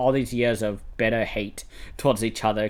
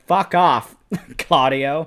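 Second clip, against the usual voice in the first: louder, higher mock dismissal; scornful retort; 3 semitones higher, 5 dB louder, slower than usual. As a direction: tell him off with a laugh breaking in. Retelling angry reply to director.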